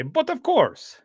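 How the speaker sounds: hammed-up Russian "But of course"; Russian accent. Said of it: "in a Russian accent".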